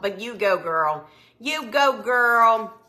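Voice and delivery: ordinary delivery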